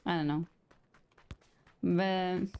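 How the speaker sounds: normal talking voice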